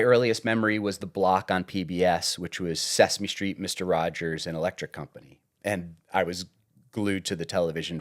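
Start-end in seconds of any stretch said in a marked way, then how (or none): none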